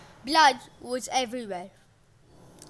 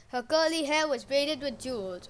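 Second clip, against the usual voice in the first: ordinary delivery, nothing marked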